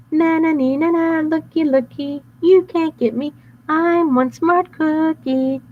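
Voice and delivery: high sing-song teasing chant; slow mocking nyah-nyah; taunting cookie. Cookie taunting the bad boys, high sing-song.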